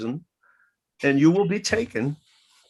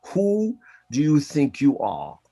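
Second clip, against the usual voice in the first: slow, lightly British voice of Alan Watts; a hint of a British lecturer asking slowly; Alan Watts impression. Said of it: "had this beautiful British voice".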